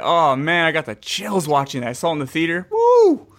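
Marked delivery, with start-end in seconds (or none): 2.7-3.2 loud, high whoop; excited cheer. Real, not acted. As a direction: let out a big whoop. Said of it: "excited cheer".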